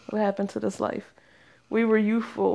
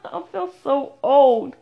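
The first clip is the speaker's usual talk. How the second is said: slow, higher groan through a laugh; laughing self-mockery; 4 semitones higher, 5 dB louder than usual. Real, not acted. Podcaster embarrassed about showing her age, laughing. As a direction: lament it while laughing.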